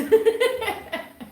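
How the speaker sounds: high-pitched laugh. Real, not acted. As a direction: burst into a high laugh at your own joke. Laughs at own joke about taxes.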